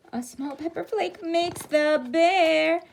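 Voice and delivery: gasping excitement. Shown as a demonstration. Repeat after a gasp, higher.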